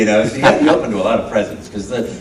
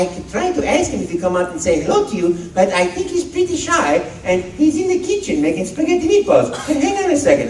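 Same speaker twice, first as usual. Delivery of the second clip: higher, playful Italian plumber; Mario voice; 12 semitones higher than usual. Retold or imitated. Telling a child that Luigi is shy, a high playful Mario voice. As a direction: tease the kid in character. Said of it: "And I'd say".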